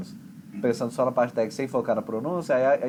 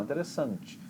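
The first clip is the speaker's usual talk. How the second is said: "Interessante" with the force on SAN; stress near the end; 9 dB quieter than usual. Shown as a demonstration. Teacher repeating the Portuguese pattern, force at the end. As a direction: save the push for SAN in "Interessante". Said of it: "dá a força lá no final".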